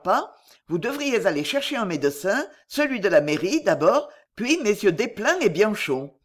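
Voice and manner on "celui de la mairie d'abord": usual speaking voice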